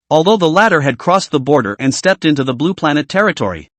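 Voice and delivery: plain reading voice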